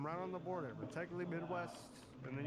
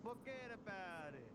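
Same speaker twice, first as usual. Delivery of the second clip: soft, higher Italian-American catchphrase; Italian-American accent; 9 semitones higher, 11 dB quieter than usual. Pretend. Salvatore practises his catchphrase, soft Italian-American accent. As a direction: toss it out softly in a put-on Italian-American accent. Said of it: "turns into a Jersey accent".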